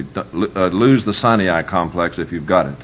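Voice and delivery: ordinary talk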